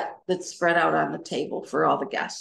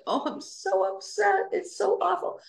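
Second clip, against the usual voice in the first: high, hammed-up distraught voice; a mock overdramatic lament, pitched way up; mock upset wail; 12 semitones higher than usual. Mocking herself as overdramatic at the party, pitched high. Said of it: "I wasn't like".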